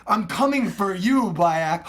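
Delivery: slow, loud menacing taunt; smack talk shout. Retold or imitated. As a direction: threaten like a game enemy. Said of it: "smack talking".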